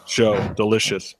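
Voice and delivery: chewing while talking; mouth full. Real, not acted. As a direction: talk through a mouthful. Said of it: "I'm eating an apple".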